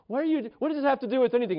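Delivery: high-pitched, rushed incredulous question; baffled protest. Retold or imitated. Karate pupil questions his teacher's odd chore, baffled protest. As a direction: imitate a confused pupil protesting fast and high.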